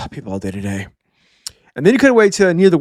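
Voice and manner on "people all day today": much lower, softer, drained voice; weary mutter. Retold or imitated. Bjorn exhausted after his brother's antics, low and soft. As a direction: sigh it out, totally done. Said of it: "See Bjorn just like".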